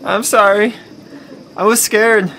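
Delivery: loud, pleading apology; scared apology. Acted. Trapped man to the woman he left, pleading.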